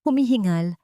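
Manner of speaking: ordinary delivery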